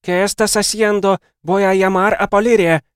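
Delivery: high, loud shouted Spanish; shouting. A narrator's reading. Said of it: "закричала она". (громко кричит высоким голосом)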